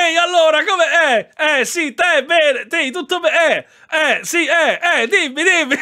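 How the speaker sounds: high, loud burst of amused recognition; loud, high-pitched phone-call mimicry on the edge of laughter; excited mimicry. Streamer mimics the old men's phone small talk, loud excited mimicry.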